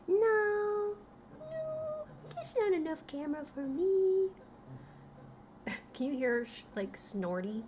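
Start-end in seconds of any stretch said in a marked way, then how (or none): 0.1-4.3 high whiny pet voice; high petulant dog complaint; dog's voice. Acted. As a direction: whine it as the dog.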